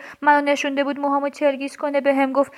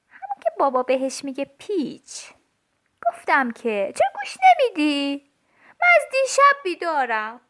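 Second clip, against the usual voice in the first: ordinary delivery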